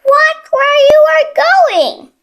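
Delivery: a high, sharp warning from a fussy hen. A narrator's reading. Henny Penny warns Chicken Little, high fussy warning. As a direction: scold the runaway chick from the nest. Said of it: "warned Henny Penny".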